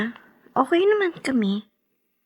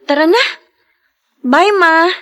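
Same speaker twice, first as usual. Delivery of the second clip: calling out; 6 semitones higher, 7 dB louder than usual. Acted. Leaving grave before rain.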